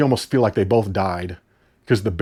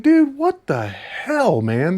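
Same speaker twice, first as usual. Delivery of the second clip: exasperated disbelief; 8 semitones higher, much slower than usual. Real, not acted. Exasperated reaction to movie.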